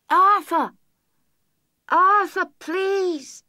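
high, distant, plaintive calling of a name; a lost voice begging from far off; high ghostly wail from far away; distant pleading wail. A narrator's reading.

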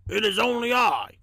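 gruff little talking train; small, gruff storybook voice. A narrator's reading. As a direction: rasp it out like a small train character. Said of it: "gruff voice".

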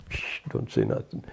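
put-on whispering a quick hush to a child. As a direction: hush them so no one hears. Mother hushing child under fascism.

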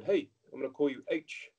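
put-on quick New Zealand accent quote; Kiwi accent. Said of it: "in the Kiwi accent".

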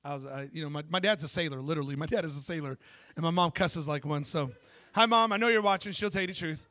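ordinary talk

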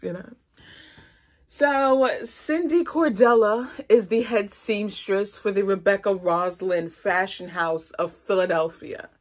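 ordinary talk

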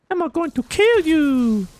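high, slow hype call; excited call-out. Real, not acted. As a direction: cheer on the teammate. Hyping teammate.

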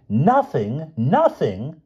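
high, loud outraged disbelief; incredulous. Acted. Francine throws back the druggist's excuse, incredulous. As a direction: throw the word back, rising both times.

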